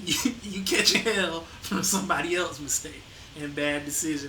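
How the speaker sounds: ordinary delivery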